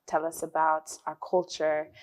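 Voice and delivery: ordinary talk, nothing marked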